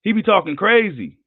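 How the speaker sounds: usual speaking voice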